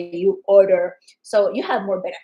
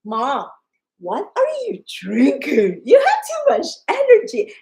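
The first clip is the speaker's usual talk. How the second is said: building to a much higher, louder cry; a kid amazed at mom; imitating amazed kid; 5 semitones higher, 6 dB louder than usual. Kid asking mom what she's drinking, in rising amazed voice. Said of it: "her husband and one of her kids is like".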